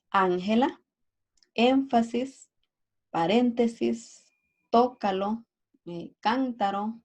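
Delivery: ÁNgela, ÉNfasis, paRÉNtesis, TÓcalo, CÁNtaro, each punched early; antepenultimate-syllable stress. Shown as a demonstration. Teacher giving examples of esdrújulas, antepenultimate stress on ángela, énfasis, paréntesis, tócalo, cántaro. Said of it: "está la antepenúltima sílaba".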